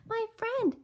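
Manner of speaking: high, small voice; faint tiny voice. A narrator's reading. Tiny mayor calls up to Horton, high faint voice. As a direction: pipe up faintly from far below. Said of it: "The voice was so faint".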